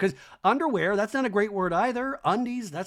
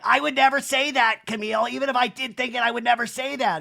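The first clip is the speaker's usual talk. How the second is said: high, loud mock protest; hammed-up high-pitched denial; Kyle; 7 semitones higher, 4 dB louder than usual. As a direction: argue indignantly in an exaggerated impression. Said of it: "where Kyle's like".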